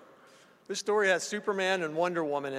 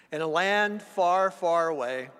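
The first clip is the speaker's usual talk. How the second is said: fireside storyteller; 5 dB louder than usual. Shown as a demonstration. Fairy-tale opening to audience.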